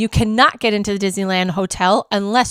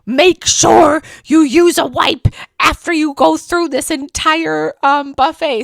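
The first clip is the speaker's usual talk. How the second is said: slow, higher, emphatic warning to the table; a hint of alarmed instruction to the family; mock urgent warning; 5 semitones higher, 4 dB louder, slower than usual. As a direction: warn everyone slowly and seriously. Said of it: "I now tell the whole table".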